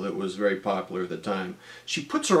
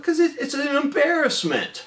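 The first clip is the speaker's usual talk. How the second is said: put-on kid's indignant voice. Kid justifying rejection.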